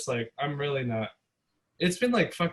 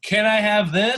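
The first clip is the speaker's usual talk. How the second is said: pushy customer; 8 semitones higher, 8 dB louder than usual. Retold or imitated. Mimicking customer leaning in.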